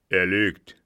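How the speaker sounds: loud, angry denial; short burst of anger. A narrator's reading. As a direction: blurt out an accusation in anger. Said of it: "trieb diese Worte in einem zornigen Tone".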